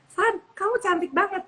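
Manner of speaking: high, bright-hearted praise; mean every word of it; sincere compliment. Shown as a demonstration. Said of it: "Pasti kamu seneng dong".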